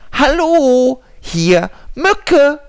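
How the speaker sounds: high, loud squeaky mosquito; tiny mosquito voice. Acted. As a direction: chirp it like a tiny insect. Mosquito answers.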